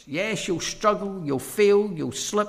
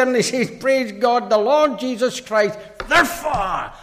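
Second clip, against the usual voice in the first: raised, ringing pulpit delivery; forceful preaching; 9 semitones higher, 9 dB louder than usual. Real, not acted. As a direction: preach it out high and loud.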